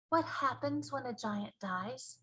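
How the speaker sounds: put-on a puzzled small girl; Sophie's voice. Sophie puzzled by the giant, softly.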